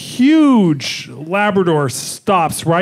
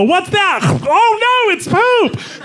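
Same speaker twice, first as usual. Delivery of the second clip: high squeaky shouted dog voice; 13 semitones higher, much slower than usual. Retold or imitated. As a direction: shriek it like a startled little dog.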